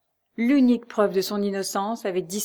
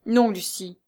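ordinary delivery

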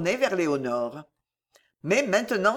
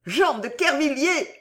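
high, strident call; madwoman's howl; 8 semitones higher than usual. A narrator's reading. Madwoman advancing on the cardinal, high howl. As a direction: call his name wildly, pitched high. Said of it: "hurla la folle en marchant sur lui".

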